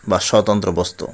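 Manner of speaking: ordinary delivery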